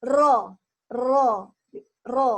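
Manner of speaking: drilling "Ro" with a buzzing trill; rolled R demo. Shown as a demonstration. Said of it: "R, ya".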